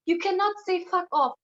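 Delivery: lightly mock his nagging tone; imitating a lecturing boyfriend. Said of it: "and he's like".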